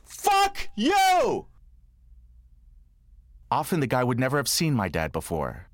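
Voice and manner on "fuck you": high angry shout; furious yell. Retold or imitated. Father storming into the principal's office, mock furious yell. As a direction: blast it at the principal. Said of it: "go storming into the principal's office".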